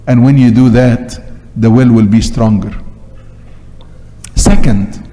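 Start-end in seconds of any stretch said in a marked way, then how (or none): none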